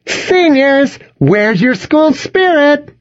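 very high, drawn-out pep-rally call; high, slow cheerleader holler without much volume. A narrator's reading. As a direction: call out to rally a whole crowd. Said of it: "Jill called".

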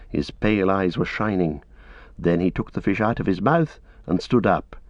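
ordinary delivery

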